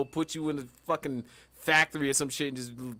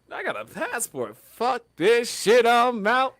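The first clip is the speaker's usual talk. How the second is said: fed up, walking out; 5 semitones higher, 3 dB louder, slower than usual. Retold or imitated. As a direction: build from calm to a loud slow "I'm out". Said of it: "I'm like".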